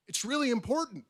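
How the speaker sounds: really punched high and bright; read with its exclamation point. Shown as a demonstration. Reading her own essay line, emphatic exclamation. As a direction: sell the exclamation mark on really. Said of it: "there's an exclamation point".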